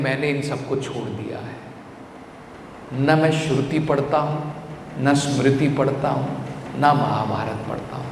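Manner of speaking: low, subdued voice of a devotee; calm renouncer. Acted. Devotee tells why he left the scriptures, calm and low. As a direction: quote him softly and low, evenly.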